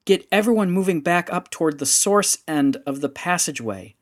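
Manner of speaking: urgent order. A narrator's reading. Rendra orders Yanfrey to evacuate after the blast, urgent and loud.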